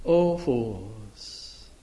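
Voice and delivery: low, soft, tender reproach; gentle rebuke. Acted. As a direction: chide them gently, like a loving teacher. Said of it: "I'm sure he said it gently".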